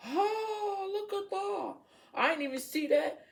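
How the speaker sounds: high, excited discovery; sudden delighted surprise at the card; surprised gasp. Real, not acted.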